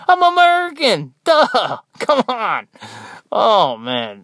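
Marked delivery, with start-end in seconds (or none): 0.1-2.7 high, loud sarcastic outburst; hammed-up "isn't it obvious" comeback; mock exasperated retort. Nurse answering "you're not from here", mock exasperation.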